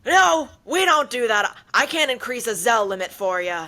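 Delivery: mocking an unhelpful branch clerk refusing loudly; blunt bank clerk. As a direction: slam the door on the request. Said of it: "She goes".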